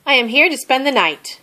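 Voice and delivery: cheerful mouse announcing his arrival; happy exclaim. A narrator's reading. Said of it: "exclaimed Mouse with a happy wiggle".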